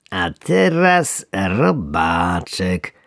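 slow, relishing fisherman; gleeful. Acted. Fisherman gleefully shakes the worm can. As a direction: savour the moment. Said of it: "rzekł wędkarz z uciechą".

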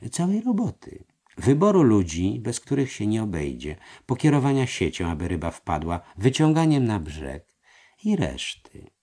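voiced treasurer. A narrator's reading. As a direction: coax him into agreeing. Treasurer persuading master. (podskarbi przekonuje mistrza)

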